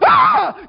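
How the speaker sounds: sudden high, loud yelp; scream of pain. Acted. Preacher acting out a hand on a hot stove, pained scream. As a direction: yell as if scalded.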